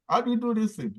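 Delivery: high-pitched, marvelling question; amazed question. Retold or imitated. Host marvelling at an actress's switching accents, amazed. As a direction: ask it in high-pitched wonder.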